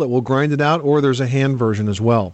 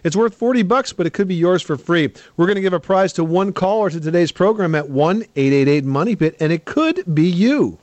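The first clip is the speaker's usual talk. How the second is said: upbeat giveaway pitch; radio contest promo; 3 semitones higher than usual. Acted. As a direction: sell the prize to callers. Show intro and giveaway.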